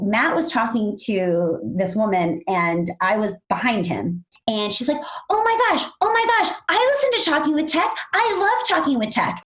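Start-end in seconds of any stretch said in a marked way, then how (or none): 5.3-9.4 high-pitched gushing imitation; excited fan. Woman at the booth loves the podcast, excited. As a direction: gush like a thrilled superfan. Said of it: "she was so excited to meet me".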